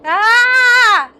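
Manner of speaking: loud high scream of fear. Acted. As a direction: scream as terror hits. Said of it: "I don't wanna scream".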